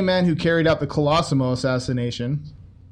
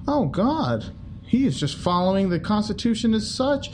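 higher, gushing fake admiration; mock praise; 7 semitones higher than usual. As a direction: oversell Capone as a civil rights hero.

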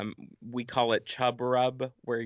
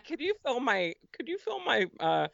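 much higher, playful ask; 9 semitones higher than usual. Pretend. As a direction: tease it up high, laughing. Joking request to dentist.

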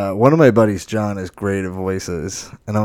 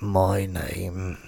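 lightly voiced old man ghost; a hint of an eerie old man; old man spirit; 2 semitones lower, 6 dB quieter than usual. Quoting a dead spirit from a horror movie, lightly voiced old man. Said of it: "there's, like, an old man".

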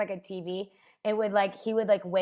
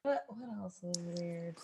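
whispering; 13 dB quieter, slower than usual. Real, not acted. Whispered aside.

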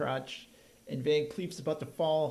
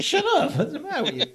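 much higher, louder, laughing through it; laughing mock retort; 10 semitones higher, 6 dB louder, faster than usual. Host imitating the heroine telling off Brimley, laughing. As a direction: snap back while cracking up. Imitating Yancey Butler's character.

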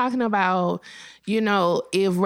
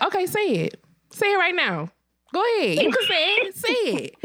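high, pressing dare; repeated dare; 7 semitones higher than usual. Retold or imitated. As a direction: keep pushing them to say it. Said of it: "was like".

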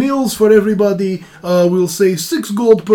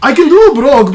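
normal talking voice

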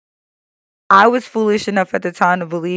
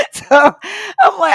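laughing; 12 semitones higher, 4 dB louder, much slower than usual. Real, not acted. Laughs, slow and high.

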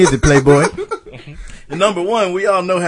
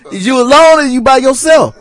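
loud; 8 semitones higher, 8 dB louder than usual. Pretend. Loud mock question.

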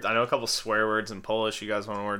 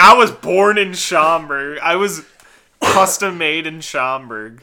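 loud, higher, slow hammed-up boast; mocking imitation of friend; 5 semitones higher, 10 dB louder, slower than usual. Teasing a friend about his hometown, loud slow mock voice. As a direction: draw it out in a teasing put-on voice. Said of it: "Chris is like".

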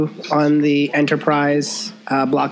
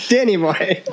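laughing; 2 semitones higher than usual. Real, not acted. Correcting his own name, laughing.

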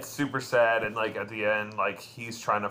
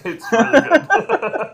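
laughing; 14 semitones higher, 10 dB louder, slower than usual. Real, not acted.

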